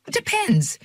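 high-pitched, hedging reply; chatty checkout girl. A narrator's reading. Checkout girl answering a doubt about camping, high chatty voice.

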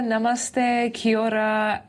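ordinary talk

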